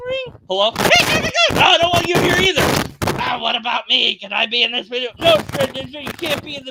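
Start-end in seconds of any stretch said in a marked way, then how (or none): 0.1-2.6 loud, high, fast shout; shouting them off. Pretend. YouTuber yelling at toy intruders in his video, loud shout. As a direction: shoo them away at full volume. Shooing toy characters away.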